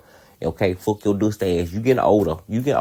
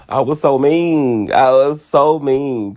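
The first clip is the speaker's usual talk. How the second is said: slow, louder, leaning on so; emphatic regret; 6 semitones higher, 6 dB louder, much slower than usual. Real, not acted. Admitting to viewers how she treated him, slow and louder. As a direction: drag it out like a real confession.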